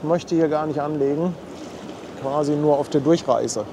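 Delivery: usual speaking voice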